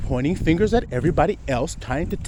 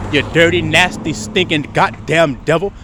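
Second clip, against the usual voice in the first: louder, spitting each insult out; imitated harsh insult; 4 dB louder than usual. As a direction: hurl the insults like an angry accuser. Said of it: "they didn't say it like that".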